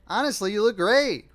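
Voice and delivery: enthusiastic. A narrator's reading. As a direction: gush encouragingly. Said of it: "repeated Perry enthusiastically".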